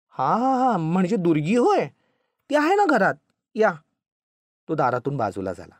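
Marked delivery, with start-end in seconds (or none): 0.1-3.7 high, louder dawning recognition; flustered welcome, jumping up in pitch; sudden realisation. A narrator's reading. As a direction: catch on and eagerly invite in. Husband suddenly understands, welcomes the visitor.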